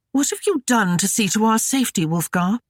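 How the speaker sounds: high, fast taunting lady; scolding woman. A narrator's reading. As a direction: voice her as a sneering scold. Gwyneth's rasping temper.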